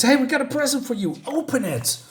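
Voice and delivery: high, quick, eager character voice; acted excitement. Creature handing Alice a present, high excited voice. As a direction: pitch it high and urge them on.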